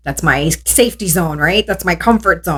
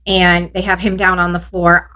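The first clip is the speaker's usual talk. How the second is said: ordinary talk, nothing marked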